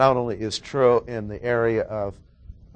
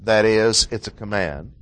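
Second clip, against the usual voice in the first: ordinary talk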